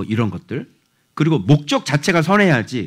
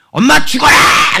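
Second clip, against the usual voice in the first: much higher, loud, slow shout; mock harsh shout; 14 semitones higher, 9 dB louder, slower than usual. A child yelling cruel words at mom, shouted slowly. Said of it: "이러고 던지면 엄마 죽잖아".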